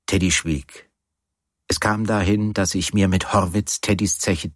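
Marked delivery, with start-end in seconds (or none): none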